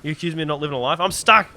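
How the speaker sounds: rising to a loud yell; mock outraged shout. Real, not acted.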